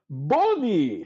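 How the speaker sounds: throat clear then loud startled Bonnie; caught-out barkeep. Acted. Durnan caught hiding the player, loud startled greeting. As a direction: sound falsely surprised to see her.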